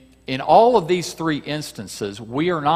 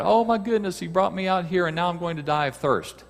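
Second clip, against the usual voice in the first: raised-pitch mock lament; crying out; 7 semitones higher than usual. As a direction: cry out in dismayed complaint. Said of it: "He's crying out".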